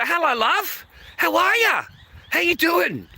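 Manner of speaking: high, sugary shop greeting; mock sweet greeting. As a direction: chirp it up high to charm her. Greeting smoke shop lady sweetly.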